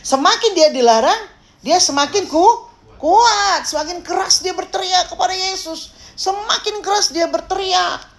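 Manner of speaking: high-pitched, insistent preaching; impassioned preaching. Real, not acted. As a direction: build it up high and emphatic. Preacher builds up blind man's persistence. (khotbah berapi-api bernada tinggi)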